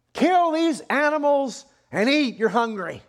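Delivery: loud, high, drawn-out command; commanding voice from heaven. Acted. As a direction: proclaim the order slowly and forcefully. God's command repeated to Peter.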